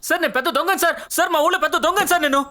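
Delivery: shouting eagerly. Acted. Volunteering to the boss, shouting to be heard. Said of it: "ఎందుకురా అరుస్తున్నావు".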